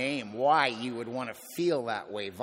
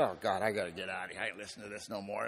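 low, fast muttered complaint under the breath; muttered disgust; 5 semitones lower, 6 dB quieter, faster than usual. Retold or imitated. To himself in the meeting, fast low grumbling. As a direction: grumble it low and quick like you want out. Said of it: "And I said".

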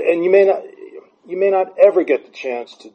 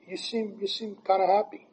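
"You seem kinda happy" in a higher, softer, lightly voiced mom; a gentle mom's voice, pitched up and hushed; imitating mom; 8 semitones higher, 10 dB quieter than usual. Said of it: "And she goes".

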